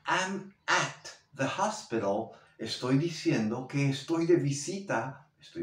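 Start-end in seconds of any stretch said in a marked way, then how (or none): 0.0-2.3 at in "I'm at the hospital" stressed, slow. Shown as a demonstration. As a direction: lean on the word at, slowly. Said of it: "si yo te llamo y te digo".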